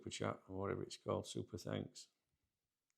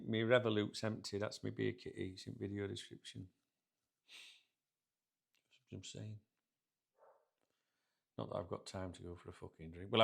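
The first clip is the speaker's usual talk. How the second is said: ordinary talk, nothing marked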